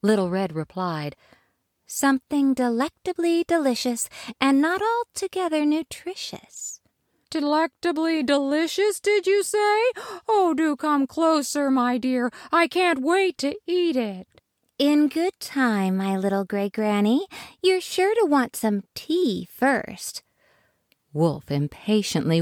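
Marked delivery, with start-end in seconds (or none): 1.9-6.8 put-on high, bright little girl voice; Little Red's voice. Little Red to wolf-granny, high girlish voice. 7.3-14.3 high, put-on granny voice, eager; wolf's Granny voice. Wolf luring Little Red closer, high fake granny. 14.8-20.2 put-on high, slow girlish voice; Little Red's voice. Little Red to wolf, high slow girl voice.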